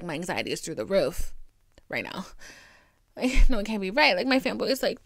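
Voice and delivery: ordinary talk, nothing marked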